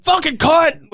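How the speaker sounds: high-pitched mock curse; imitated falling man. Replaying the sheriff's curse for a friend, high mock outburst. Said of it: "The way he just, like".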